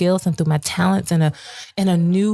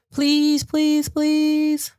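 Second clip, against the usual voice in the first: pleading; 8 semitones higher, much slower than usual. Retold or imitated. Begging for help on goodwill.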